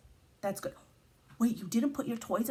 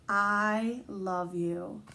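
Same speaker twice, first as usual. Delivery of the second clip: tender mom; 2 semitones lower, much slower than usual. Acted. Teacher reading the mom's loving words to David, slow and tender. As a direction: say it like a hug.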